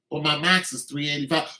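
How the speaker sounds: normal talking voice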